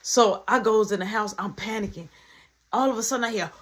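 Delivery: normal talking voice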